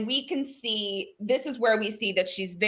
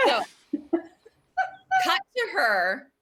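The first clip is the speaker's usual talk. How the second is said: ordinary delivery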